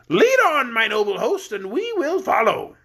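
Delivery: high, jolly voice of a stout king; fat jolly king. Acted.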